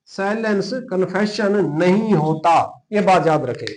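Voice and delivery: ordinary delivery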